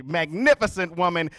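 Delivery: ordinary delivery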